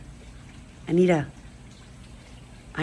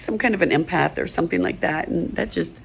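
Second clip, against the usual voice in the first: normal talking voice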